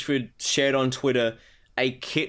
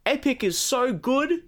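much higher, cocky boasting voice; mock Randy Pitchford; 8 semitones higher, slower than usual. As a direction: brag like a cocky boss. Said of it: "he's gone".

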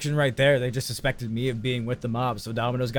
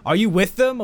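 higher, punched-up interrogation; raised-voice mock questioning; insistent accusation; 6 semitones higher, 4 dB louder than usual. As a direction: demand it as an accusation. Question repeated, now more accusing.

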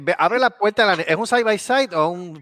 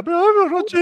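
high, whimpering plea; mock sobbing man; 4 dB louder than usual. Crying man begs the policeman, high whimper. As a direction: wail it like a crybaby. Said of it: "llorando como una magdalena".

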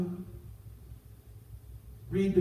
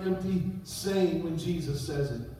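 usual speaking voice

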